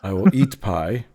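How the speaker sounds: normal talking voice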